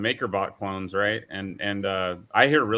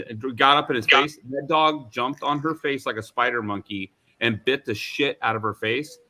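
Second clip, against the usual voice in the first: normal talking voice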